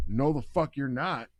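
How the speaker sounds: loud, slow, emphatic refusal; retold firm refusal.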